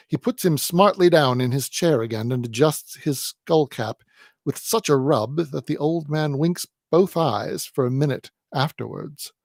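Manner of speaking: ordinary delivery, nothing marked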